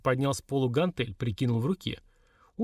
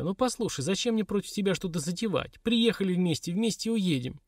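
higher-pitched protest; mild yell; 6 semitones higher than usual. A narrator's reading. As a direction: plead your case in a raised pitch. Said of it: "заорала Лилька". (возмущённо, повышенным тоном)

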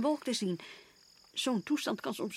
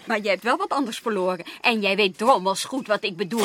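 louder, pushing accusation; angry accusation; 9 dB louder than usual. Acted. Wife loudly accusing her husband of a hidden loss. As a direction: hammer the accusation home.

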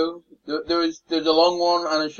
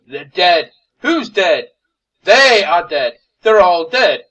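acted back-and-forth, building much louder; exasperated exclamations; 9 dB louder, slower than usual. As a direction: hammer it out with rising volume. Acted quarrel over the dead crew.

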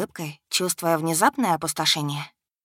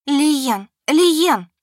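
desperate loud call, much higher; frantic shout, rising louder; 9 semitones higher, 7 dB louder than usual. A narrator's reading. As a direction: scream his name for help. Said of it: "закричала".

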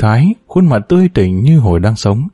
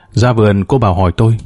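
ordinary delivery, nothing marked

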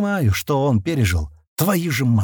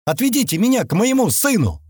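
high-pitched insistent cry, only mildly raised; yelling woman; 10 semitones higher than usual. A narrator's reading. Woman in the front rows demanding her son, mock yell. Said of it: "снова заорала тётка в первых рядах".

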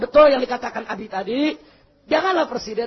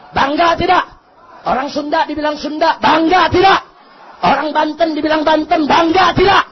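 loud call-and-response questions; rallying shout; 2 semitones higher, 7 dB louder than usual. Real, not acted. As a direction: fire the question out at the crowd. Rousing the crowd with questions. (seruan lantang membakar semangat massa)

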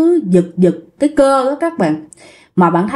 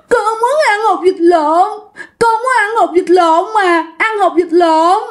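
put-on whiny childlike crying; 9 semitones higher than usual. Possessed woman whining to the security guard, crying like a child. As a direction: whimper it like a pouting child in tears. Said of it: "giống như đứa con nít mà nó nhõng nhẽo".

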